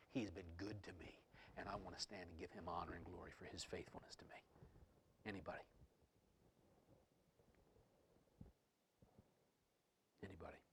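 soft, low testimony of thanks; hushed invitation. Real, not acted. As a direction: drop to a near murmur, inviting a response. Gently invites congregation to testify.

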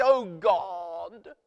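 hammed-up British accent on a prayer; comic plummy British accent; mock British accent. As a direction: pray in a put-on British accent, for laughs. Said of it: "praying in a British accent".

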